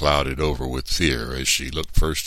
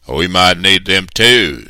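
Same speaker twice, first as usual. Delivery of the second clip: loud, higher, drawn-out reply; boss giving orders; 5 semitones higher, 12 dB louder, slower than usual. A narrator's reading. Mister Anderson answers his man, loud and bossy. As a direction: sound like the man in charge.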